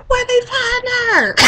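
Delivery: slow, high squeal of disbelief; high-pitched mock disbelief. Host mocking the casting choice, high disbelieving squeal. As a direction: squeal it, on the edge of laughter.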